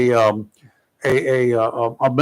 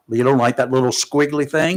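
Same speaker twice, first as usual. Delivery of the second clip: normal talking voice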